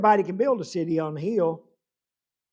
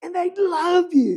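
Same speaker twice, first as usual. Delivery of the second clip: high-pitched, disbelieving friend; incredulous protest; 11 semitones higher than usual. Retold or imitated. Friend contradicting the violinist about the cheering crowd, high and incredulous. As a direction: protest in a high voice, insisting on the praise.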